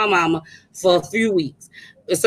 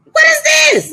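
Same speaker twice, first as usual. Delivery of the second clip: high, loud shocked shriek; shocked cry; 3 dB louder, slower than usual. Retold or imitated. Retelling the dead car at night, shocked loud cry. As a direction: yelp it out in disbelief.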